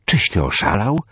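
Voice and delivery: aghast disbelief at a crazy plan; terrified. A narrator's reading. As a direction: blurt it out in horror. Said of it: "odmalowało się przerażenie".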